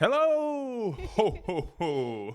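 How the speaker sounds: booming jolly Santa laugh; loud ho ho ho like Santa. Pretend. Pretends to be Santa.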